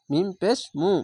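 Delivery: ordinary delivery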